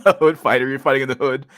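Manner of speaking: amused disbelief at the chat message, laughing; laughing repeat. Real, not acted. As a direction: savour the silly phrase, cracking up.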